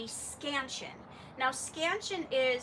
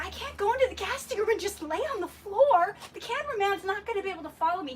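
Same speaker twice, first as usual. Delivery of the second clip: higher, fast, whining objection; mock protest; 7 semitones higher, 5 dB louder, faster than usual. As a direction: argue it's impossible, flustered. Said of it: "and I was like".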